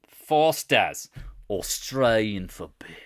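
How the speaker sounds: slow, hammy Aussie ad slogan; Australian accent. Acted. Trader recalling the beer ad, Australian accent. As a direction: announce it in a broad Australian accent.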